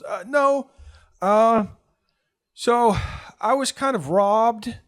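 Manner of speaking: high, loud, slow admission; sheepish kid. Acted. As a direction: confess it reluctantly. Kid admits robbery.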